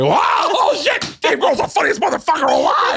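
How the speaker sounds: over the top fan gush. Retold or imitated. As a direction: blurt it out like a starstruck fan. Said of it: "I did the fucking Fred Armisen laugh to him".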